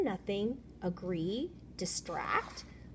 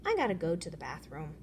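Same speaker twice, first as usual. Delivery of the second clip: usual speaking voice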